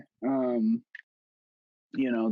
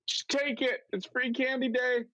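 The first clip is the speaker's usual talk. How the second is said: high, slow, pleading whine; high begging voice, drawn out; pleading inner voice; 11 semitones higher, slower than usual. Retold or imitated. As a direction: beg them to take it and leave. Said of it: "and I'm like".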